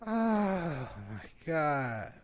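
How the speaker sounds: long, soft, sinking moan; despairing groan. Real, not acted. Fan lamenting his hero's death, despairing groan. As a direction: drag it out in dismay.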